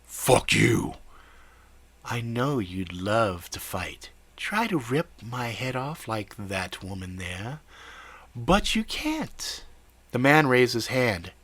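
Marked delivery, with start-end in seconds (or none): 0.0-1.0 angry. Acted. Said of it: "anger was in his voice". 2.0-9.6 slow, soft, taunting villain; nasal whiny voice. Acted. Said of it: "nasal, whiny voice".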